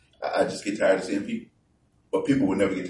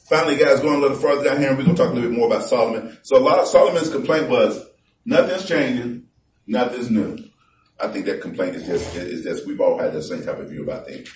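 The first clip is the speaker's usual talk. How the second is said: preaching at full force; 6 semitones higher, 8 dB louder than usual. Acted. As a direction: boom the verses out to the congregation. Preacher reading scripture loudly.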